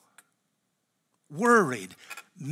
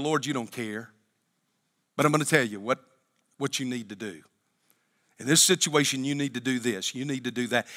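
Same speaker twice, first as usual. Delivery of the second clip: quiet bossy prayer; 5 semitones lower, 6 dB quieter, faster than usual. Retold or imitated. Believer instructing God.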